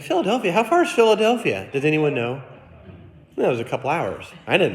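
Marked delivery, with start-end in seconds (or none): 0.0-2.4 a mock-shocked Philadelphia?; incredulous. To the travel agent, shocked by the airport. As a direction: voice jumps high in disbelief. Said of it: "I'm like".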